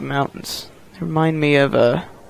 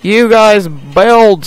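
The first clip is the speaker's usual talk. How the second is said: loud foreman command; 4 semitones higher, 7 dB louder, much slower than usual. Pretend. Ordering workers in game.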